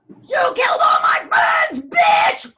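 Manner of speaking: high, loud, slow revenge yell; vengeful scream. Pretend. Vicky back for revenge screams at the killer. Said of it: "gone back and go".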